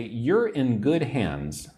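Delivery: slow, careful phrase for learners; slow, clear teaching read. Shown as a demonstration. Teacher reading a phrase slowly to the class. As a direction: says the key phrase slowly for students. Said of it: "So if I say".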